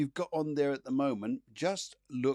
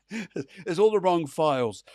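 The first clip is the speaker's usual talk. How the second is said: laughing; 5 semitones higher, 6 dB louder, slower than usual. Real, not acted. Laughing at own screen mix-up.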